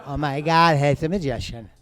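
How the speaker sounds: mock drunk girl gushing at a bar. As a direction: play a tipsy girl at 1:30 am. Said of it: "and she go".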